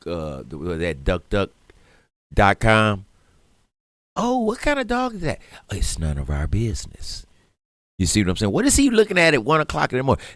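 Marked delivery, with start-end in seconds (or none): 4.2-5.4 much higher prying question; mock nosy busybody. Mocking a nosy person. 8.5-10.2 higher, fast prying question; mock nosy busybody. Mocking a nosy person.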